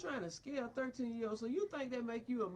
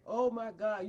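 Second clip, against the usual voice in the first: mock exclamation; 2 semitones lower, 7 dB louder than usual. Real, not acted. Mocking sissies.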